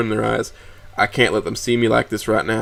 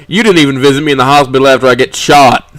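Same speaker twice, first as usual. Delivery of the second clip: loud, wounded accusation; hurt anger; 3 semitones higher, 6 dB louder than usual. A narrator's reading. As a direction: hurl the old wound at the father. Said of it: "conflict between anger and sadness becomes more apparent".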